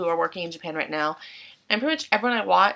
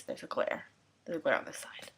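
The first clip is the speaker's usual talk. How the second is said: 1 whisper; 6 semitones lower, 10 dB quieter than usual. Real, not acted. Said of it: "whispered aside about camera glare".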